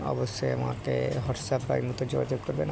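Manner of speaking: ordinary delivery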